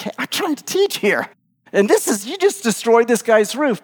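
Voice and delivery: high-pitched, exasperated protest; a little annoyed. Acted. Teacher reacting as the roof is dug open, annoyed. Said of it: "I might be a little annoyed".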